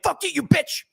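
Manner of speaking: sudden high-pitched mock snarl; mock snap. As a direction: flip from sweet to furious, hammed-up. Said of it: "they just snap".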